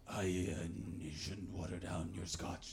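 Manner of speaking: low, slow Scottish man impression; booming-chest voice, done low and soft; imitated chesty Scotsman. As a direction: speak from deep in the chest, slowly. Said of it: "talking through his chest through a megaphone".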